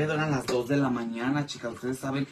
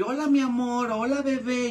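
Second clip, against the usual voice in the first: flirty catcalling; 9 semitones higher than usual. Retold or imitated. Women teasing passing men for fun, mock flirty. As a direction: coo it teasingly, pitched way up. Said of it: "les decimos así".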